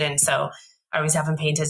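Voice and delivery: usual speaking voice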